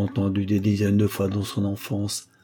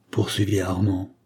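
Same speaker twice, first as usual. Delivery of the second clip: plain reading voice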